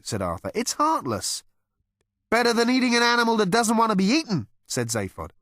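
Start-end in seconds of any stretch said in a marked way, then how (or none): 0.5-1.4 much higher, fretful voice; mild fussy protest; Arthur character. A narrator's reading. Arthur protesting to Zaphod, in a much higher fretful voice. 2.3-4.5 much higher, louder, fast brash voice; Zaphod character. A narrator's reading. Zaphod retorting to Arthur, in a much higher, louder, fast brash voice.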